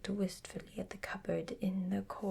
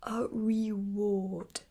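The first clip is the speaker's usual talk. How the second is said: loudly; 2 semitones higher, 6 dB louder, much slower than usual. Acted. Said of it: "said Owl very loudly".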